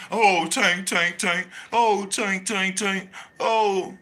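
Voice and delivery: hammed-up adoring sigh; mock fawning fans. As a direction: gush over Tank like a starstruck fan. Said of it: "They just wanna rub on his ass".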